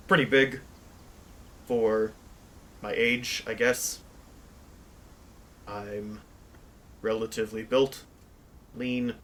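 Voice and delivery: measured self-description with long pauses between phrases. Acted. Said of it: "his canon character voice".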